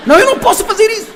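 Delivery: high, rushed refusal, hammed up; whiny mock protest. Wife balking at God's order to hug her husband, mock whiny protest.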